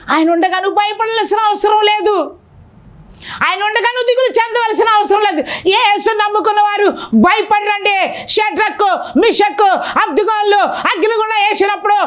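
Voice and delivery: impassioned preacher; fervent preaching. Acted. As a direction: preach it out to the congregation. Preaching at full force.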